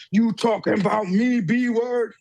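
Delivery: a lightly voiced bully, taunting; mildly mock tough challenge; locker room bully. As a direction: sneer it like a gym bully picking a fight. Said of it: "comes out like a locker room bully".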